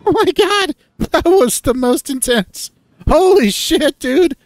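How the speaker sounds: very high, loud post-ride whooping; frenzied, high-pitched hollering; exhilarated yelling. Acted. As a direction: shriek it, thrilled and blown away by the ride. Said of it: "two laughing, panting hyenas step out".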